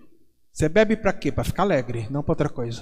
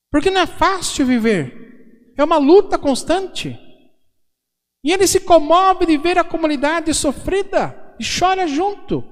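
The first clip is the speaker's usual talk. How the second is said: louder and higher, pressing each phrase like a preacher; fervent preaching; 8 semitones higher, 3 dB louder than usual. Acted. Priest urging the congregation that Jesus weeps with them, impassioned preaching. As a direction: preach it with rising urgency to the crowd.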